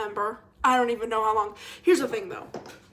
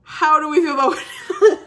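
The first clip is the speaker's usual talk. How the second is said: louder, higher, laughing through it; mock-gossipy, tease the audience; teasing with a laugh; 4 semitones higher, 11 dB louder than usual. Teasing the viewers about Whitney with laughter. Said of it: "I'm just kidding".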